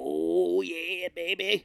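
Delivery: slow, sleazy put-on gnome king. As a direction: leer it out slowly like a lecherous king. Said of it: "the Gnome King's like".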